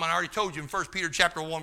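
ordinary delivery

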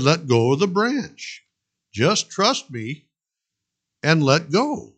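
slow, deliberate repeat; slow patient command. Acted. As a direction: say it slowly and firmly.

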